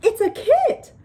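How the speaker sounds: high, loud excited blurt; excited child. A narrator's reading. Child cutting in about the baby skunk, excited.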